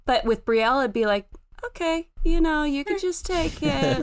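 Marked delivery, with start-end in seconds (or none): 1.6-4.0 higher, sweet and yielding; gentle giving-in. Acted. Imitating brother letting little sister take the toy, gentle. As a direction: hand it over tenderly, in a raised voice. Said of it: "really gentle".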